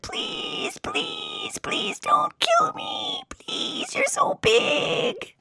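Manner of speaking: high, soft, whispered squeaky pleading, slow; scared tiny demon. Acted. Shrunken demon begging the huge deer for mercy, tiny whispered squeak. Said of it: "the demon got scared and said".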